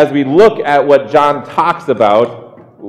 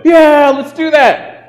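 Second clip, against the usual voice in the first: high, louder mock chorus of agreement; eager disciples; 4 dB louder than usual. The other disciples agreeing to the plan, high mock chorus. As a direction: chime in like a gullible crowd. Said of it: "and the rest of them go".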